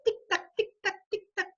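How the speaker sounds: high, light, evenly spaced tick-tock; ticking clock imitation. Shown as a demonstration. Teacher demonstrates onomatopoeia of a clock, ticking imitation. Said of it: "Lo mismo el reloj, cuando dice".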